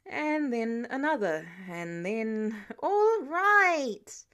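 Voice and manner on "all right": loud, higher, drawn-out cry to stop; exasperated interruption. Acted. Peter stopping Tony's run of videos, exasperated and loud. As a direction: cut it off with a loud protest.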